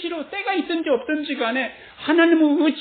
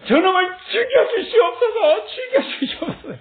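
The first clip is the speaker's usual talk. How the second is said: angry prayer; 8 semitones higher than usual. Retold or imitated. David angrily begging God to kill his enemy. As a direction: cry out for vengeance in rage. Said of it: "이게 화가 나면 이런 거예요".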